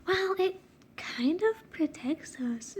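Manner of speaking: unvoiced whispered line, slow. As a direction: breathe it out like a secret. Reading character's secret line.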